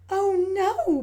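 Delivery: high, loud little pig's cry of dismay. Acted. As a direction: wail it like a shocked child. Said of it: "Peppa cried".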